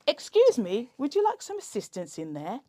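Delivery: a touch of a chirpy shop assistant; lightly voiced helpful shop worker.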